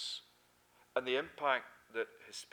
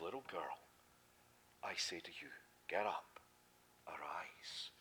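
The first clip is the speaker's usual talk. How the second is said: low, soft near-whisper command; gentle whisper; 10 semitones lower, 9 dB quieter than usual. Acted. Jesus calling the dead girl to rise, whispered. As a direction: tenderly wake her with a whisper.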